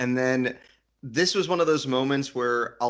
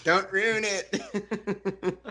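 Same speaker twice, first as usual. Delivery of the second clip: drawn out; 8 semitones higher, much slower than usual. Acted. Pleading jokingly with co-host.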